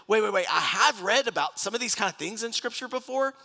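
high, urgent, flustered protest; retold freak-out. Preacher recalls his first deliverance experience, freaked out and high-pitched. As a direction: stop everything, pitch jumping up. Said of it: "I was freaked out because I went".

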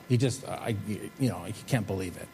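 ordinary talk, nothing marked

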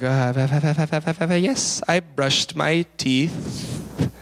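stammering start, then very slow drawn-out answer; stall, then drag out an unconvincing lie; awkward hesitation. Retold or imitated. Boy lies to mom about brushing, awkward and halting. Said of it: "There's weird hesitation and awkwardness in his voice".

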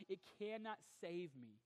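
usual speaking voice

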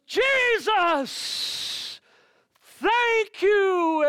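very high, booming mock blessing; hammed-up shouted grace; mimicked preachy prayer. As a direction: imitate an over-loud man praying. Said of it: "he began to preach a sermon and prayer".

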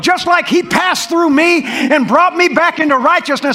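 loud, high preaching shout; shouting preacher. Acted. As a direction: preach it full force.

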